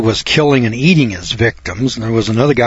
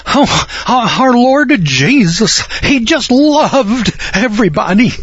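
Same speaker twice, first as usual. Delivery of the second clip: high, slow, gushing mock-pious voice; sugary mocking falsetto; mock syrupy piety; 10 semitones higher, slower than usual. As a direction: parody a syrupy churchgoer swooning over Jesus. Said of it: "they get kind of syrupy and they say".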